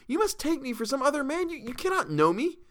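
high frantic plea; frantic pleading. A narrator's reading. Captive pleads mistaken identity, high frantic voice. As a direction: insist you are the wrong man, frantic. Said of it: "I cried again".

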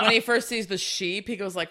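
normal talking voice

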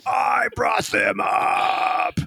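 high crying shout, slowing at the end; sobbing protest, pitched very high; anguished wail. Acted. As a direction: wail it like something precious is torn away. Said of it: "you see Pawson go".

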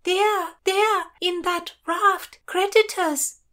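high, loud alarmed call. A narrator's reading. Lady on deck spots supposed creditors in a raft, calling out. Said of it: "one of the Christinas called".

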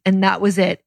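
ordinary delivery

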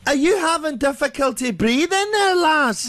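high, jolly Scottish voice; chirpy Scots brogue, unbothered; Scottish accent. Retold or imitated. Scottish guy voiced in story.

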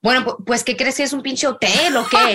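a hint of a fed-up parent scolding; imitating the Viner. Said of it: "He's like".

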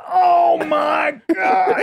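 long drawn-out high wail of dismay; hammed-up dad howl, stretched very slowly; horrified dad. Dad discovering bait spilled in his car, horrified wail.

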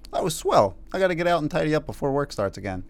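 lightly voiced gee-whiz kid, fast finish; chirpy square coworker, quoted; wholesome 1950s kid. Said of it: "Did, did he actually use the word swell?".

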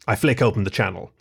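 ordinary delivery, nothing marked

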